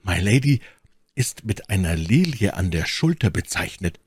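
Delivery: usual speaking voice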